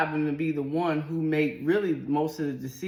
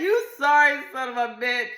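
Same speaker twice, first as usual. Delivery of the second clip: high, loud and laughing; laughing retelling; 8 semitones higher, 4 dB louder, faster than usual. Retold or imitated. Wife retells yelling at husband, laughing. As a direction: laugh through the insult. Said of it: "I yelled it out".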